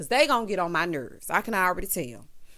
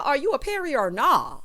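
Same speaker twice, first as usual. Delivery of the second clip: ordinary talk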